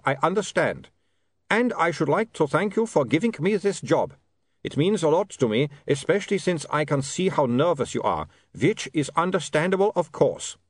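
put-on high, courteous vampire accent; accented vampire, grateful. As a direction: thank them in a Transylvanian-style accent. Vampire character's dialogue.

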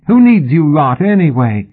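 high, loud, unsteady defiance; voice shaking. A narrator's reading. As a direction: defy them with a shaking voice. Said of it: "his voice shaking".

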